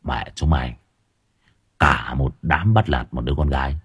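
gangster voice. Acted. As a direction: speak like a street mob boss. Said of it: "cái giọng xã hội đen". (giọng xã hội đen trầm, đe dọa)

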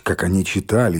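plain reading voice

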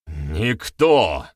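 loud, falling shout of denial. A narrator's reading. Accused youth shouts back at his accuser, loud denial. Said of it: "вновь выкрикнул он".

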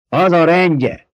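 shouted from the crowd. A narrator's reading. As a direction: call it out from the back. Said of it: "kiáltotta valaki". (bekiabálás a tömegből, lassan, magasabb hangon)

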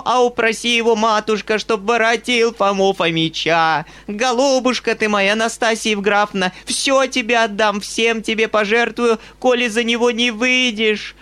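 high, loud wailing plea; old mistress wailing, bribing the governess; frantic old woman. A narrator's reading. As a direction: beg like your life depends on it. Old woman begging Nastenka.

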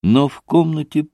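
usual speaking voice

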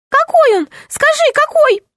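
high, eager little gnome; tiny gnome voice. Acted. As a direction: press her, a small excited gnome. (взволнованный голос гнома)

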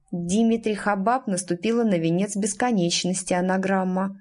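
ordinary delivery

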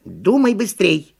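strict monkey scolding; stern monkey. A narrator's reading. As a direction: order it sternly, stretching the words. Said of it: "ещё строже приказала".

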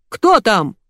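restrained narrated yell. A narrator's reading. Guard hearing a sneeze, moderate yell. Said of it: "заорал Егор".

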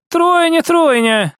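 high, eager repetition; thrilled sister's voice; excited confirmation. Acted.

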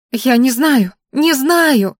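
high, loud hysterical outburst; hysterical cry. A narrator's reading. Girl cries out hysterically to her captors, loud and high. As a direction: blurt it in panic. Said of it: "воскликнула она истерично".

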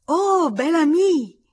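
high, loud, drawn-out cry of joy; delighted shout. A narrator's reading. Little girl greeting a favourite visitor, shouting with delight. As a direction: cry out like an overjoyed child. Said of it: "battendo le mani e gridando".

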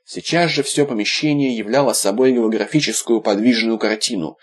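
ordinary delivery

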